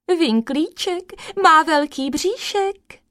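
high, slow taunting call; teasing shout. A narrator's reading. Little grasshoppers taunt the man coming to catch them, teasing shout. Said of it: "vykřikli".

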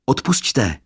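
high, loud call of apology; crying out. A narrator's reading. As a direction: call out sorry up to the balcony. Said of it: "vykřik Lukáš". (zvolání omluvy)

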